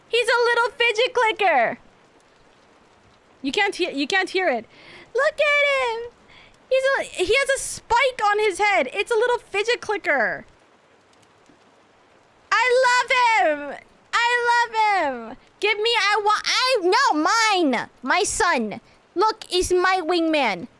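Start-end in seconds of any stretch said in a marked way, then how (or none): 0.1-1.8 cooing gush. Real, not acted. Gushing over toy. 5.1-20.7 high, squealing delight over a tiny toy; excited gushing. Real, not acted.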